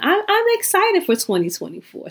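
bright, high burst of excitement; excited. Real, not acted. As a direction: let the excitement spill out. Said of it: "So this is excitement you hear".

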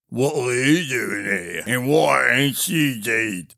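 low-key, slurred and slow giant voice; slow-witted giant. A narrator's reading. Giant startled to see Niles, slow slurred giant. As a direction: slur it slowly as the giant. Giant's garbled question, later translated.

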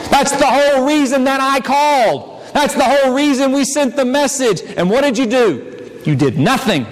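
ordinary delivery

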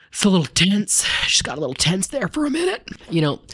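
high-pitched, playful aside; mock tense. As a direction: pitch it way up as if uneasy. Comic aside after tense reading.

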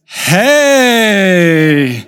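long drawn-out uncertain hey; faked recognition. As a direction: stretch the greeting out, stalling for a name. Fakes recognising a forgotten acquaintance.

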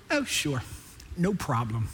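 low, soft, unhurried reply; understated, calm assurance; Jesus. Acted. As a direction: answer quietly and easily. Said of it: "he simply looks at him and goes".

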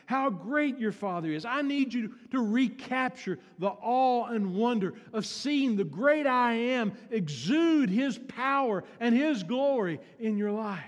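loud, high-pitched preacher voice; full-force preaching. Acted. God commanding Moses before he faces Pharaoh, in full-force preaching. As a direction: thunder it out as God.

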